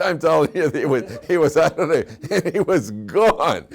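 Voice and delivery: can barely get it out for laughing; laughing through it. Real, not acted. Laughing while telling sleeper story.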